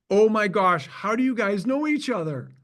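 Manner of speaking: high, loud burst of delighted surprise; excited surprise. Retold or imitated. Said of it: "He was like".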